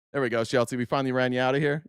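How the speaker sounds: ordinary talk, nothing marked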